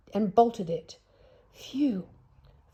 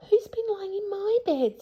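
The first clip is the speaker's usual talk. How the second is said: much higher, sing-song mother bear. Acted. Mother Bear asking about her bed, high voice. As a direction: lift it up like a fussy mother bear. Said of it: "said Mother Bear".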